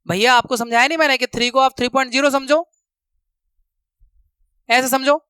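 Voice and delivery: usual speaking voice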